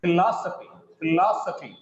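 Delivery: "philosophy" with the beat on "los"; stress before "sophy". Shown as a demonstration. Teacher showing which syllable gets stress, "philosophy" stressed on "los". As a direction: hit the second syllable of "philosophy" each time. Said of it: "The syllable preceding the ending gets stress here".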